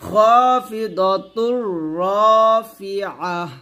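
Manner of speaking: রাফিআহ slow with ain, heavy দ; correct pronunciation demo. Shown as a demonstration. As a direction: model খাফিদাতুর রাফিআহ slowly. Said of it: "দ মুঠা করতে হবে". (ধীরে সঠিক উচ্চারণ)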